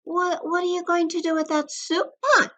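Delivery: high little bunny voice asking; a small rabbit, wide-eyed and curious. A narrator's reading. Bunny asking the varmint about the pot, high and curious. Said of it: "asked Buddy".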